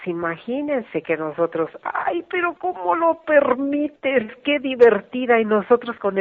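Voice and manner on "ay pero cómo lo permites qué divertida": much higher, slow, exaggerated disbelief; incredulous mock outrage. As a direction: squeal it like an outraged child. Kids react in mock disbelief.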